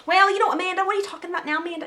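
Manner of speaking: put-on high, loud caricature American accent; mock American accent. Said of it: "do her American accent".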